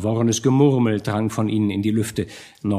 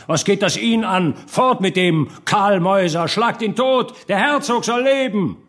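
high, raised mob cries; shouting; 8 semitones higher, 3 dB louder than usual. A narrator's reading. Burghers turn on the doctor and hail the duke, shouting. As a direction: heckle and cheer as an angry crowd. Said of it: "schrien die Bürger".

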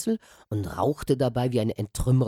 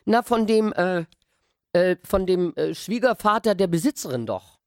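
put-on higher, loud halting boy; flustered stammer; 6 semitones higher, 4 dB louder than usual. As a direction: stumble for an answer.

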